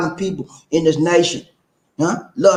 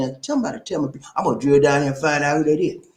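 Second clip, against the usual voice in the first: ordinary delivery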